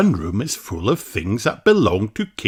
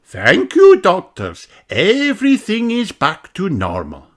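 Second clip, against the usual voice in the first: grateful teacher; 10 semitones higher, 4 dB louder than usual. A narrator's reading. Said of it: "says Madame Gazelle".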